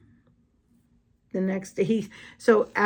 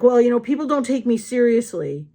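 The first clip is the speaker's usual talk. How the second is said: higher, louder mock-angry protest; imitated angry talk; 5 semitones higher, 5 dB louder than usual. Date defending how he talks to her, imitated angry protest. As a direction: mimic his worked-up complaint. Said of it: "about how angry he talks".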